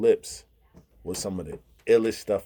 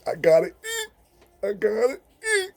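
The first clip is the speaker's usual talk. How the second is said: higher whiny mock voice; deaf friend's thoughts; 13 semitones higher than usual. Voicing a deaf friend's hungry thought, high whiny mock. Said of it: "he think".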